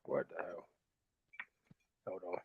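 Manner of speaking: mic dropping out, barely audible; distant broken-up line; cutting out. Real, not acted. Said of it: "I cannot even hear you".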